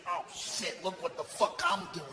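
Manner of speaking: low, hushed crazy-man voice; quiet, creepy self-amazement; crazy stitching guy. Acted. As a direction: mutter it softly like a madman at work. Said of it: "but he was kinda crazy".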